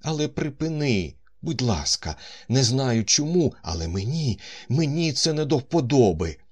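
uneasy. A narrator's reading. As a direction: ask her to stop, nervous. Man uneasy, begs her stop. (тривожно просить)

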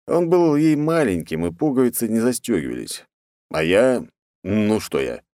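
plain reading voice

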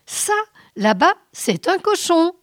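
high, loud, insistent assertion. A narrator's reading. Captain maintains his view to Alvor, high and insistent. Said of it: "maintenait le capitaine".

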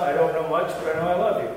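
deadpan recital at ordinary pitch; understated comic line, evenly delivered; low-key Norwegian love song. Shown as a demonstration. Pastor telling his congregation a Norwegian joke, low-key. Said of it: "Let's try it again".

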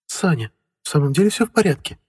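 worried wife voice. A narrator's reading. Worried wife asks husband.

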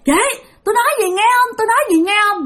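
high, insistent retold call. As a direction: call after someone who keeps ignoring you. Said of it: "Kêu". (gọi với theo, giọng cao gắt)

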